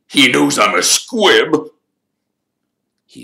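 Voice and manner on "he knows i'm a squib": high, loud, bitter confession; anguished blurt. A narrator's reading. Caretaker confessing his secret to the room, anguished. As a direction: force the shameful word out loudly.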